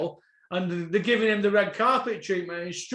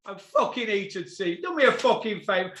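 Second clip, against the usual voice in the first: ordinary talk, nothing marked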